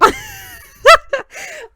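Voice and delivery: a burst of high-pitched laughter; high squealing laugh. Real, not acted. Vlogger cracking up after mangling a French tongue twister, squealing laugh. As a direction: squeal with laughter at your own stumble.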